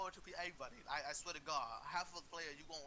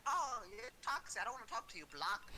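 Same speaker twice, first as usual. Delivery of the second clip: mocking little boy; 6 semitones higher than usual. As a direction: mimic a petty little boy sulking. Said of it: "what kid boys say, like, a little boy say".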